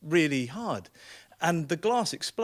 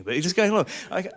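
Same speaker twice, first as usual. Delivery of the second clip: normal talking voice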